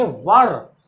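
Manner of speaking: "वर" with a short central vowel; correct "were". Shown as a demonstration. Teacher telling class what to read, "वर" as "vur". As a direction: say "वर" as vur, not vair. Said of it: "इसको वेयर नहीं पढ़ के".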